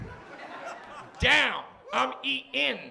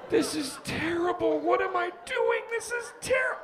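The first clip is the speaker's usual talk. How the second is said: panicked; 7 semitones higher than usual. Retold or imitated. Panicked inner monologue.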